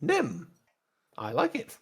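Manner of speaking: high-pitched pit droid; Nim. Acted. Droid takes its new short name, high droid voice. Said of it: "I'll call you Nim".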